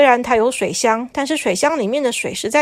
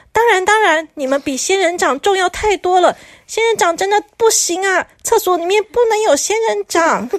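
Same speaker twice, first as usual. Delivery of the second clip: higher, emphatic flattering envoy; lightly voiced keen messenger; eager messenger; 8 semitones higher than usual. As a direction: reassure them fast.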